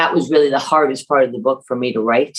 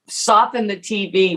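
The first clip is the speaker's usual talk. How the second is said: imitating mom; 5 semitones higher than usual. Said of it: "my mother would say".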